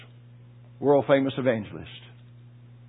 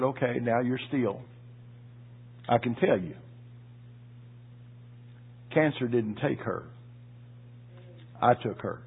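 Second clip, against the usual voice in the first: low, soft, steady reassurance; calm voice of God; 4 semitones lower, 11 dB quieter than usual. Retold or imitated. God quietly comforting the grieving preacher, low and soft. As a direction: speak gently like God comforting him. Voicing God's calm reply.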